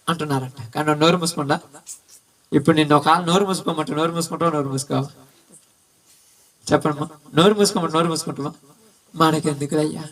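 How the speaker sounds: ordinary talk, nothing marked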